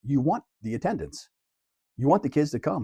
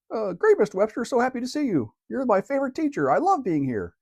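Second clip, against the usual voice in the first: much higher, playful fake-cheerful kid; silly mock student voice; 12 semitones higher than usual. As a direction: squeak out a sarcastic thank-you like a pupil. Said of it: "Something silly".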